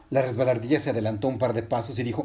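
usual speaking voice

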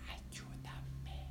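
breathy secret whisper for help. Acted. Girl whispering a plea to her friend. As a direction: Patinina whispers to Mandarina so no one hears. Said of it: "y le dijo".

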